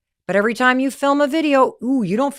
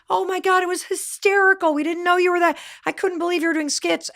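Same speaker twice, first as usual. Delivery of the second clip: higher, amazed mock rave; pitched-up imitation of the team raving; amazed colleagues; 8 semitones higher than usual. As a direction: gush about the show like stunned fans. Team gushing about the show.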